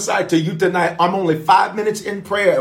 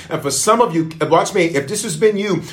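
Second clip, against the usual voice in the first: ordinary delivery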